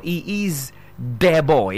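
"de" with a d and an eh vowel, slowly; wrong pronunciation. Shown as a demonstration. Teacher showing the wrong article, slow mispronunciation. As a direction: say "de boy" with no th at all. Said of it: "that is wrong, extremely wrong".

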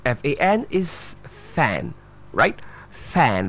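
"fan" with an open front a, as in cat; correct pronunciation. Shown as a demonstration. Teacher modelling the word F A N, careful pronunciation. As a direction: say "fan" with a wide, short a. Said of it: "That's the way you pronounce the word".

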